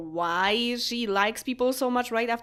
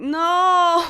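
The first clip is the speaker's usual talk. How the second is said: dismayed cry; 7 semitones higher, 7 dB louder than usual. Real, not acted. Rivals leave, game fight ruined.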